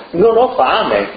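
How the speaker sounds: plain reading voice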